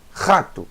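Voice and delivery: "Rato" starting with a throaty Het rasp; guttural Het sound. Shown as a demonstration. Teacher likens it to Het, guttural R in "Rato". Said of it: "usando o som do Het".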